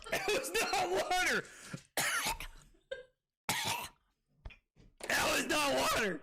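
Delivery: laughing shout. Real, not acted. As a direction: yell it while cracking up. Laughing protest about the drink.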